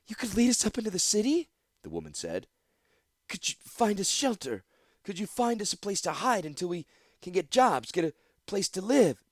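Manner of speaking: high, quick, hopeful questions; eager hope. Acted. Woman eagerly asks Gix for shelter. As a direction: ask with rising excitement.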